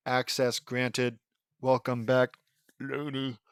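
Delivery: measured robotic announcement with gaps between phrases; computer voice. Acted. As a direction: recite it word by word like a machine. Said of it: "says a computer voice".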